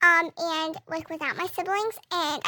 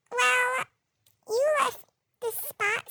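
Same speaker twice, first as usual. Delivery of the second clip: higher, slow, smug sister voice; mock the sister's know-it-all tone; mimicking sister; 5 semitones higher, slower than usual. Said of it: "she always goes".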